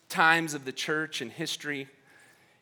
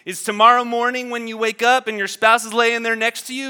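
normal talking voice

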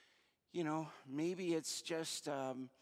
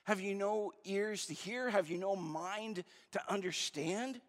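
raised pitch, exasperated questioning; frustrated; 5 semitones higher than usual. Acted. Jesus to his arguing disciples, frustrated. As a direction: press each question with mounting exasperation. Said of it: "He gets frustrated at".

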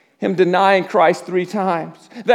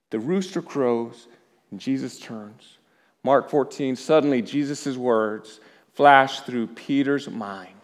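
low, soft, slowing into long pauses; grave, hushed storytelling; hushed solemn telling; 6 semitones lower, 8 dB quieter than usual. A narrator's reading. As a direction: let the voice sink almost to nothing. Solemn telling of Peter's failure.

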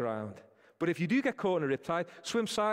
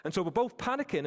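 ordinary delivery

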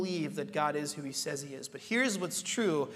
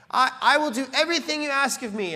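loud, higher earnest promise; eager pledge; 7 semitones higher, 6 dB louder than usual. Retold or imitated. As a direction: promise it all with zeal.

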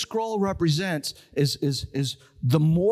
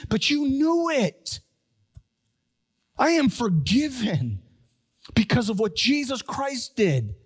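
fervent preaching; 6 semitones higher than usual. Acted. Fervent sermon appeal.

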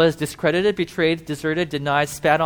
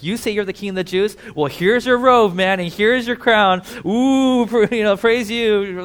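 higher, louder and slower sneering jeer; mocking taunt; 5 semitones higher, 6 dB louder than usual. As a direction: jeer at him like a cruel soldier. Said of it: "Like, they're mocking him".

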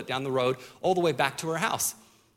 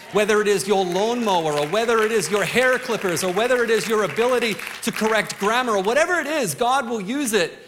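preaching louder, climbing higher to a peak; building sermon; 4 semitones higher, 3 dB louder than usual. Acted. Pastor rallying the congregation, rising preaching fervor. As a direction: drive the list up to a rousing climax.

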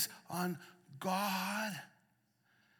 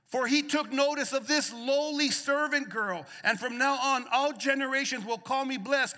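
rising preacher's cadence, louder on the peaks; preaching at full force; 8 semitones higher, 9 dB louder than usual. Real, not acted. Pastor preaching hope to the church, full force. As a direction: preach it out to the congregation.

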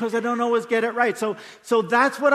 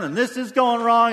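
higher and louder whiny grumble; mock complaining; 4 semitones higher, 5 dB louder than usual. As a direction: play the fed-up complainer.

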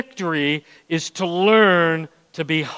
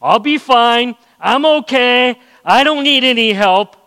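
high, louder breezy insistence that all is fine; proud brush-off; 5 semitones higher, 5 dB louder than usual. Retold or imitated. Preacher mimicking a proud believer for the congregation, raised and breezy. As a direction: wave off every offer of help. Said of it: "that proud thinking".

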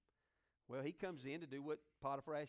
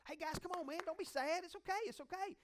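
much higher, coaxing reassurance; encouraging; 11 semitones higher than usual. Retold or imitated. Joseph cheering up the sad butler and baker, high coaxing reassurance. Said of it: "So he's encouraging them".

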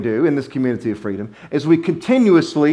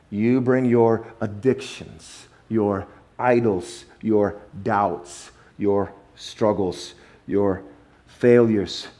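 each item slow and softer, fading to the end; slow list; 5 semitones lower, 3 dB quieter, much slower than usual. Shown as a demonstration. Preacher listing burdens the congregation brings, slow and fading. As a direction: lay each burden down slowly. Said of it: "slow list of burdens".

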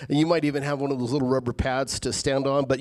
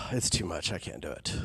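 much softer and lower, sighing in defeat; 11 semitones lower, 12 dB quieter than usual. Retold or imitated. A worn-out believer at the edge, sighing defeat. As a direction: let the breath go out of you, giving up. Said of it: "where you're like".

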